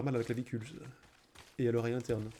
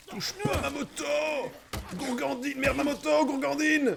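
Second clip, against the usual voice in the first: outraged yelling; 8 semitones higher than usual. Real, not acted. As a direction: yell after the thief. Bike stolen, yells at thief.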